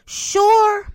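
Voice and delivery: high, loud sarcastic sure. Acted. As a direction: scoff it out sarcastically. Sarcastic mock agreement.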